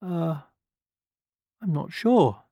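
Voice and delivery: high, hesitant hen voice; nervous hen. A narrator's reading. Hen unsure about lending the wolf a pot, nervous. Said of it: "feeling distinctly nervous".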